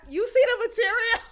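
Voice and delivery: high, quick mock line then big laugh; mock reply into laughter. Mocking the bride's imagined reply, high then laughing. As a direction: quote it then burst out laughing. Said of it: "I respond".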